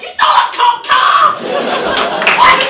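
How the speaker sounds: high, loud and slow scandalised cry; shocked shout. Retold or imitated. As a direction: gasp it out, scandalised. Youth group people react shocked.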